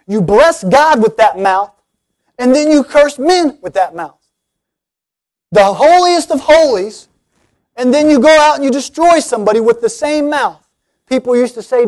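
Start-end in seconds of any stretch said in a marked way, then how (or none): 0.1-10.7 in-your-face. Acted. James confronting Juan over his mouth, in-your-face and building. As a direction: get right in their face, driving each charge home. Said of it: "get a little more in your face".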